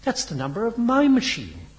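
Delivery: high-pitched indignant protest; hot indignation. A narrator's reading. Henry protests that it is his machine, hotly. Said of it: "cried Henry hotly".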